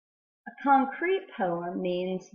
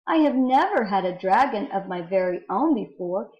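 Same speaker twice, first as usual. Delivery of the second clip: louder, lifted, rhythmic verse reading; poem reading; 3 semitones higher, 5 dB louder than usual. Acted. Teacher reading a dragon egg poem to students, big rhythmic read-aloud. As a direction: read it out big, leaning on each repeated word.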